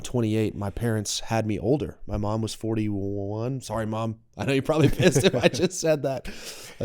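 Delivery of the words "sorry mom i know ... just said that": laughing through the line, higher and louder; laughing apology. Real, not acted. Son laughing an apology to his mom, laughing.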